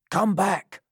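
abrupt alarmed command; sudden cry. A narrator's reading. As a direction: cry out to stop him. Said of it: "cried Sikes suddenly".

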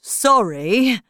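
Hatter. Acted. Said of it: "he said".

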